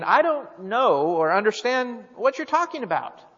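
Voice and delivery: emphatic denial. A narrator's reading. Peter answers the servant girl's accusation, read as an emphatic denial. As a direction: read it as Peter insistently denying. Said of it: "But he denied it".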